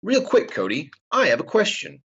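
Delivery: put-on high, sing-song kids' TV host; sarcastic kids' show voice. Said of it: "I was trying to do my dinosaur training impression".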